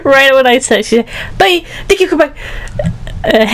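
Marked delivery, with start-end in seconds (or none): 1.4-2.3 high, hurried mock Chinese accent; imitated Chinese accent. Imitating the worker signing off, mock Chinese accent. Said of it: "In a Chinese accent?".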